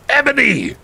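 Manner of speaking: high, hammed-up call; mock yell of the name; villain's yell. Voldemort calling to the heroine, mock yell. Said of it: "he yelled".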